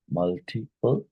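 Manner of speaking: "Multiple" broken into separate syllables. Shown as a demonstration. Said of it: "which of these is a polysyllabic".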